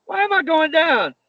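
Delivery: high, startled question mid-fall; mock panic, pitched high; alarmed. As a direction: sound caught off guard in the air. Falling during botched suplex.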